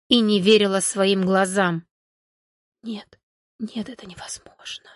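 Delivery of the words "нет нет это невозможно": trembling whisper, hushed; shaky whisper. Acted. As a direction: breathe it out in shock. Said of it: "прошептала она дрожащим голосом".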